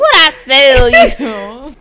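slow, stretched "What I sell you"; mock drawn-out slip. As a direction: drag out "sell you" for laughs. Exaggerates own tipsy slip.

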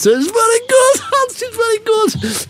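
exaggerated German boardroom praise, pitched high; German board member impression. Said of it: "the German board of Volkswagen".

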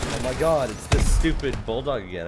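loud exasperated groan at the game; annoyed outburst. Real, not acted.

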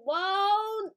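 loud, high-pitched startled cry; startled shout. Real, not acted. Gamer startled in the middle of a remark, startled shout. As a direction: cut yourself off with a sudden high, loud whoa. Startled mid-sentence during gameplay.

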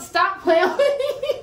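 slow, giggling plea to stop; laughing through words. Real, not acted. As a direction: laugh while telling him to quit. Laughing, prank discovered.

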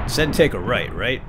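ordinary talk, nothing marked

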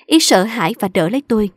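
ordinary delivery, nothing marked

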